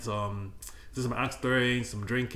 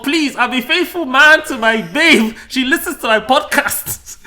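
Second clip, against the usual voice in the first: pleading loud; 9 semitones higher, 9 dB louder than usual. Real, not acted. Pleading to girlfriend on podcast.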